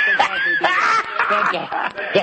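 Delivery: high laughing burst; real laughter breaking the line. Real, not acted. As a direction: laugh through the words. Laughing at own story.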